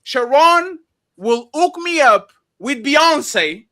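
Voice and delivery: ordinary delivery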